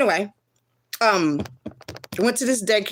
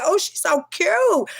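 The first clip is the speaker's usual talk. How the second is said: put-on high, drawn-out coo over a baby; retold gushing; 10 semitones higher, much slower than usual. Telling of first seeing the baby, gushing.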